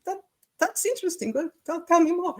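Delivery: high, mock-interested husband voice; husband's reply. Telling friends how her husband answered, high and mock-interested. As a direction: put on his polite, intrigued tone. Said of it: "and he was like".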